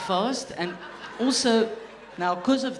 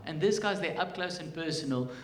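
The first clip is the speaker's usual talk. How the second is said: ordinary delivery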